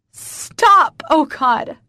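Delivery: very high, loud outburst; desperate shout to make it stop; distressed cry. A narrator's reading. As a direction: cry out in panic. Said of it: "I cry".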